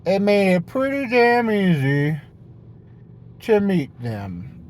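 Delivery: lightly put-on know-it-all voice; hypothetical smug person. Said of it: "So someone might say".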